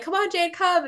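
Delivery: high-pitched pleading friends; coaxing. Retold or imitated. Friends coaxing her to come along.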